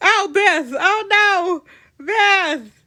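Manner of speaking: loud, high wail of sympathy; dismayed outcry. Real, not acted. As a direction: cry out in dismay for her. Reacting to Beth's heartbreak in game.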